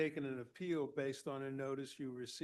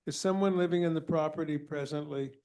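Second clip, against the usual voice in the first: raised voice to be heard; loud; 10 dB louder than usual. Real, not acted. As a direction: speak up over a bad line. Chair speaking up to faint caller.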